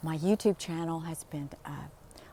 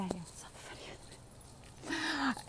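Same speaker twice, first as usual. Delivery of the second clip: breathy whispered fry; hushed, airless voice; whispered vocal fry; 8 semitones higher, 9 dB quieter than usual. Shown as a demonstration. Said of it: "oh, I saw the funniest thing yesterday".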